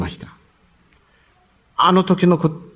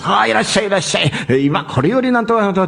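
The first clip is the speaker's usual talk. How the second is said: higher, lightly voiced barker's call; circus barker; 6 semitones higher, faster than usual. A hint of the circus barker calling people in. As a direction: sing out to the passing crowd.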